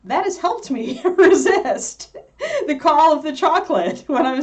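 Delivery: high, loud, laughing delivery; helpless amusement breaking into speech; laughing while talking. Real, not acted. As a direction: laugh through the words. Amused by her whiny brainstem image.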